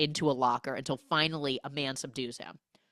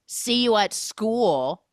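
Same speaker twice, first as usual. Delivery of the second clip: loud, higher, drawn-out mock yell; retold scream; 5 semitones higher, 7 dB louder, slower than usual. Neighbour calling to Kevin getting in the car, mock scream. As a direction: yell it slowly as a parting call. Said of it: "Ryan screams".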